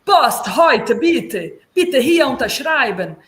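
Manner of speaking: loud, punched-out courier phrases; delivery man's German. Retold or imitated. The courier asking for a signature, loud rote delivery-man German. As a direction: call it out in choppy blocks like a delivery man. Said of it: "O entregador de".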